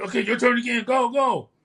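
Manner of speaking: shouted high and loud, hurrying him; retold yelling. Coach yelling at him to hurry at the bars. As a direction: bark the orders like a drill coach. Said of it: "Yelling at me".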